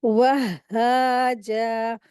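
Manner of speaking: "वहाजा" very slow, "wah-haa-jaa", doubled h and long vowels; correct shadda. Shown as a demonstration. Teacher correcting the common mistake, slow doubled-h recitation. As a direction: stretch "वहाजा", pausing on the first h. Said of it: "पढ़ेंगे अलिफ मद्दा के साथ".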